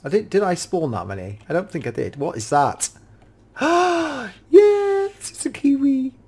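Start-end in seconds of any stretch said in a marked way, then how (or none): 3.6-4.3 gasp. Real, not acted. Spots a kiwi in game. 4.5-6.1 high, thrilled squeal of delight; excited squeal. Real, not acted. Gamer spotting a kiwi in his zoo mod, high delighted squeal.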